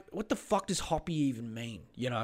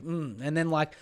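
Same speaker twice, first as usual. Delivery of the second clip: ordinary talk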